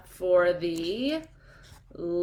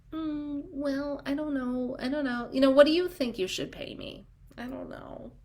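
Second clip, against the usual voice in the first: higher, softer, hesitant mumble trailing to a whisper; unsure and timid; 6 semitones higher, 4 dB quieter than usual. Retold or imitated. Mocking an insecure doula asking a client, hesitantly. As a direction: dither like a doula afraid to name her price. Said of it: "They want confidence and knowledge".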